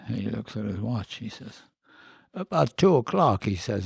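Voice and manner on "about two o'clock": slow, higher, louder Irish brogue. Retold or imitated. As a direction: imitate an old Irishman's brogue. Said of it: "with his brogue".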